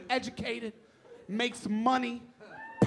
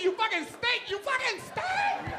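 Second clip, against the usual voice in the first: wife's insult; 8 semitones higher than usual. Acted. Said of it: "they tell you".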